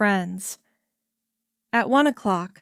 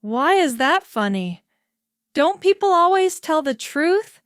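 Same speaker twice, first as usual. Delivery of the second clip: higher and louder, rising in wonder; lightly voiced Wallis, amazed; puzzled Wallis; 7 semitones higher, 4 dB louder than usual. A narrator's reading. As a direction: ask it with wide-eyed disbelief.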